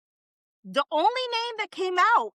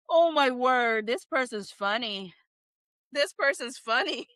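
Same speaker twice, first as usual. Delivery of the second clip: normal talking voice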